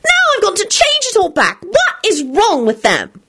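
high, slow frantic complaint; frantic Solange voice. Acted. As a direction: wail about the wasted work. Solange fretting in story.

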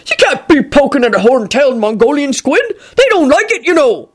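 high, scolding character voice; Hagrid snapping. A narrator's reading. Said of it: "snapped Hagrid".

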